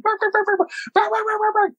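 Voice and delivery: high, rapid yapping; imitated dog barking. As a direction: mimic a dog taking off barking. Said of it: "Riley barks".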